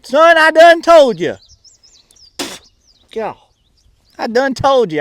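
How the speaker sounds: put-on fed-up country-boy holler; exasperated shout. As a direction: shout it indignantly in a Southern accent. Snapping at a nosy friend.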